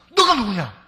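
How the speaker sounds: loud startled who-are-you. Acted. Shocked Isaac demanding who stands before him. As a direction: jolt out the question in shock. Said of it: "이삭이 깜짝 놀란 거예요".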